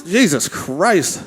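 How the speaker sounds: normal talking voice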